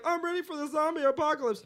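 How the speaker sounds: high, put-on eager voice; mocked zombie preppers. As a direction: imitate overconfident preppers in a high voice. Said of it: "people are like".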